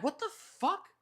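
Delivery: high-pitched stunned what the fuck; shocked disbelief. Retold or imitated. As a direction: squeak it out in disbelief. Self-quote, shocked at no boot.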